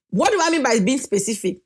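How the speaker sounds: usual speaking voice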